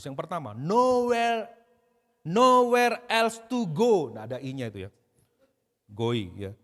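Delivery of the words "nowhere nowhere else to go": loud, high, drawn-out proclamation; preaching at full force. Acted. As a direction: proclaim it slowly, voice raised high.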